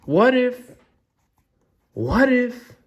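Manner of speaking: high-pitched, halting dread; dawning alarm. Acted. Gerald has an alarming realization.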